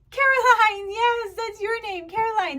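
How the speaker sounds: "Caroline" joined up, high and loud; delighted teacher praise. Pretend. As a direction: sing out praise to a small child, "Caroline" whole. Teacher celebrating pupil's name.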